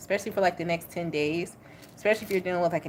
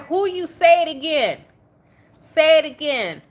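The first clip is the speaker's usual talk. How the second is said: louder, higher dare to repeat it; loud, mock-confrontational clapback; sassy challenge; 9 semitones higher, 10 dB louder than usual. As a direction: throw the words back as a dare. Voicing a sassy, challenging Sagittarius.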